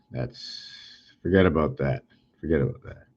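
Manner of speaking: low, soft aside to oneself; under the breath. Real, not acted. Presenter dismissing their own attempt, muttering under the breath. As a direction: drop it low and murmur it away.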